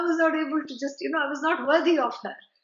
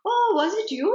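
higher, louder mock-amazed oh; delighted surprise; 7 semitones higher, 5 dB louder than usual. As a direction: feign a happy little start of surprise. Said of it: "Vishnu said".

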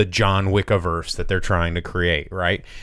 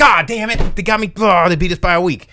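much higher and louder, mock outrage at being beaten; frustrated studio exec; 9 semitones higher, 6 dB louder than usual. Studio exec learning a rival beat them, mock loud frustration. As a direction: yelp it like you just lost the race.

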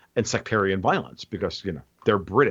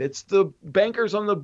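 normal talking voice

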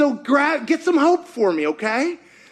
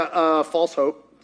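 ordinary talk, nothing marked

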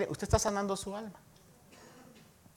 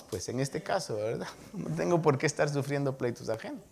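ordinary delivery

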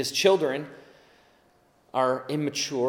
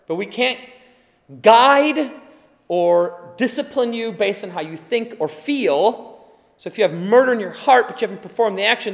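loud, raised preaching voice; fervent preaching; 5 semitones higher, 11 dB louder than usual. Acted. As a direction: preach it hard to the congregation. Preaching at full force.

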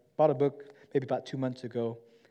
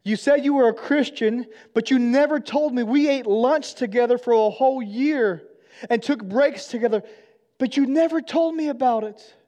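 much higher and louder, pained accusation; hurt angry outcry; 10 semitones higher, 9 dB louder than usual. Retold or imitated. Friend accusing Shane of never sharing faith, high hurt shout. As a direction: cry out betrayed, voice high and strained. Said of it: "He says".

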